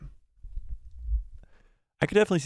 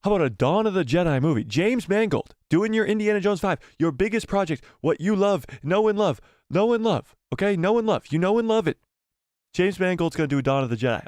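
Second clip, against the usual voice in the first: higher, fast, hyped-up sales pitch; eager pitch; 6 semitones higher than usual. Acted. Pitching a movie to the studio boss, hyped and eager. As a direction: sell the idea like it's a blockbuster.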